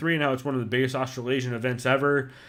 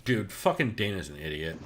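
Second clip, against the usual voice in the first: ordinary delivery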